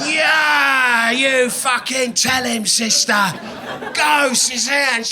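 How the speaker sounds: high, loud mock cheering; hammed-up drunken women's yell. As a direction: egg her on like a tipsy mob. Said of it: "all the pissed women are like".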